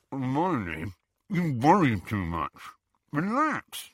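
muffled, through bulging cheeks; mouth full. A narrator's reading. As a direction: talk round a big mouthful of food. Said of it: "with his cheeks bulging".

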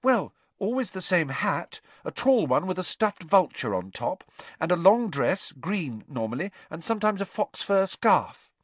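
put-on a nervous boy pleading; anxious schoolboy Neville. A narrator's reading. Neville describes grandmother.

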